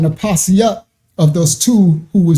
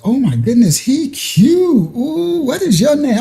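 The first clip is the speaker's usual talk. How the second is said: lightly voiced girl, higher pitch; hint of a cooing girl, pitched up; imitated girl; 7 semitones higher than usual. Storyteller mimicking a girl meeting him, lightly voiced higher. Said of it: "She's like".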